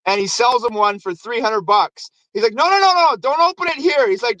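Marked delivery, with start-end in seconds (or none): 2.5-4.1 high-pitched hurried mock protest; urgent warning. Santa seller stopping the dad opening the box, mock urgent. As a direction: imitate a nervous seller stopping someone.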